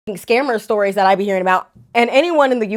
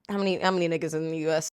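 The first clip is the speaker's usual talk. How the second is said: quick, low mutter; hushed, rapid self-talk; muttering to oneself; 6 semitones lower, 11 dB quieter, much faster than usual. Real, not acted. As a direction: mumble it fast under your breath while typing. Talking to herself while typing a search.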